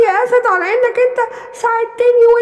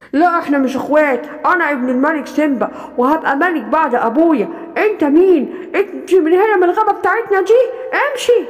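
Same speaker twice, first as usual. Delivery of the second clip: lower, cold voice telling the little lion off; bossy big lion; 7 semitones lower than usual. Acted. Big lion chasing the little lion out, lower and cold. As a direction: push the newcomer away, haughty.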